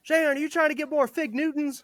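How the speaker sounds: high, mocking voice of a drill sergeant. Drill sergeant catching recruit trading food, high mocking taunt.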